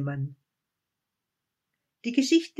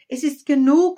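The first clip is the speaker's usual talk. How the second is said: much louder and higher, cutting in; sharp interruption; 9 semitones higher, 13 dB louder, faster than usual. A narrator's reading. Wise man cuts off the child's eager listing. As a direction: stop the list dead. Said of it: "unterbrach der Weise das Kind".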